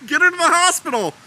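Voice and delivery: loud, high mock panic; hammed-up frantic alarm; freaking out. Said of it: "they all start freaking out".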